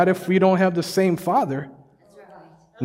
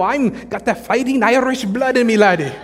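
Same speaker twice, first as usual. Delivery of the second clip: high, hammed-up Irish brogue with a laugh; mock Irish accent; 6 semitones higher than usual. Preacher mocking a friend's Irish boast, in a jokey Irish accent. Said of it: "I'm point five percent Irish".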